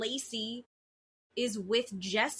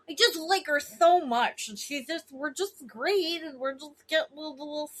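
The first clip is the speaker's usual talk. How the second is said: mock baby voice, pitched up and gushing; Tom Schwartz baby talk; 4 semitones higher, 3 dB louder than usual. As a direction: gush about a new girlfriend like a cutesy baby. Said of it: "Why are you talking like a baby?".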